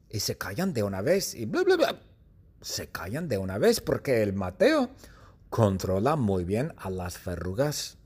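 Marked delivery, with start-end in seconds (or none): 1.5-2.0 very high squeaky chatter; parloteo agudo. Acted. Imita a las verrugas parlanchinas. (cháchara imitada, muy aguda)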